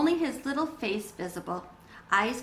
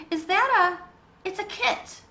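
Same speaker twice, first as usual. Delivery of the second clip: put-on high, eager child's voice; excited; 10 semitones higher, 4 dB louder, slower than usual. A narrator's reading. Bat, enchanted by the tiny creature, high and excited. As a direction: spill it out in delight at the kit.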